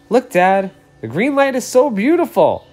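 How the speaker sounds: put-on a small boy pointing up in delight; excited little boy. Little boy telling his father, high eager child voice.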